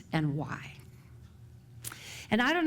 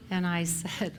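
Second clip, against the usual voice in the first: ordinary talk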